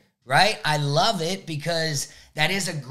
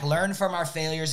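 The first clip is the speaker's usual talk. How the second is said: normal talking voice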